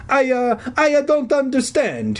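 high, halting stage-Italian; stammering Italian accent. A narrator's reading. Confused janitor answering the kids, stammering Italian accent. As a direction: stumble over it in an Italian accent. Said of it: "he stammered".

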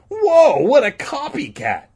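very high, loud "Whoa" of disbelief; declaring. Acted. Danny exclaiming at Patrick copying, high and loud. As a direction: exclaim at the copycat. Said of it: "Danny declared".

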